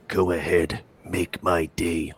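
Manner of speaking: low, slow tough-guy line; Clint Eastwood voice. Retold or imitated. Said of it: "Clinton Eastwood?".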